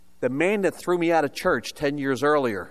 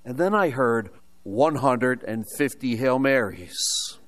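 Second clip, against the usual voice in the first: ordinary delivery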